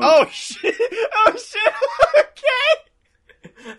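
high-pitched laughing scream; laughing shriek. Real, not acted. Wins roll, laughing screams.

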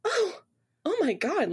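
high, acted little gasp of delight; flattered surprise. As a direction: react as if caught off guard by praise. Acted reaction to a compliment.

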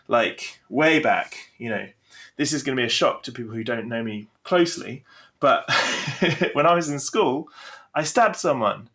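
usual speaking voice

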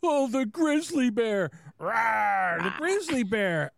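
mocking imitation. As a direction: imitate him going over the top. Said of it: "he goes in and goes".

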